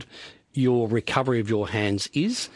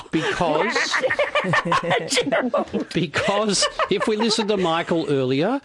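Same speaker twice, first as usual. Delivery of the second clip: laughing; 8 semitones higher than usual. Real, not acted. Laughing at own gaffer tape joke.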